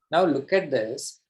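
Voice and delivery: normal talking voice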